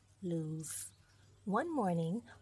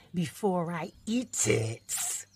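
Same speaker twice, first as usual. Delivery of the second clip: menacing wolf; 6 dB louder, slower than usual. Acted. Wolf threatens cub.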